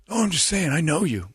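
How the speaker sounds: high-pitched, put-on defensive voice; Jeremy. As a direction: mimic a colleague excusing himself. Mocking retelling of Jeremy's excuse.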